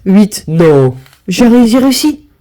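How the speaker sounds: loud burst of disbelief at the win; thrilled cry over the dice; excited shout. Real, not acted. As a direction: shout with delight at the lucky roll. Dice roll wins jackpot.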